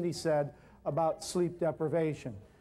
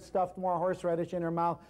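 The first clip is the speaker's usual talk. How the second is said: ordinary delivery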